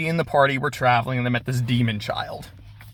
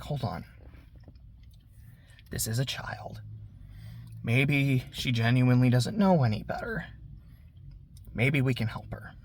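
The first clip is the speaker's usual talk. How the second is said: softer, half-whispered plea for mercy; soft and hushed; 3 semitones lower, 10 dB quieter than usual. Retold or imitated. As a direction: hush them gently, almost whispering. Village chief calms everyone.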